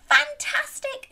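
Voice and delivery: very high, loud cheer; excited praise. Real, not acted. Storyteller praising children who joined in, high and excited.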